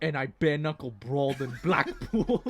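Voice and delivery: hammed-up British accent, close to laughing. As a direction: join the joke in mock British. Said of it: "you guys are doing it pretty good".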